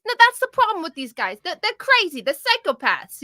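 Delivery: high, exaggerated voice of the American guest calling the others crazy; cartoony arguing guest. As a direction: squeal the accusation like a cartoon. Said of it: "he sounded like a cartoon character too".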